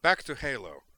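loud, high, angry retort; sharp, heated comeback; snapped. A narrator's reading. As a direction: snap it back defiantly. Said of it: "Tali snapped".

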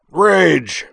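very loud, high bellow; explosive roar. A narrator's reading. Someone raging inside the dean's office, explosive roar. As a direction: blast it out as one drawn word. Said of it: "recovered from the acoustic shock".

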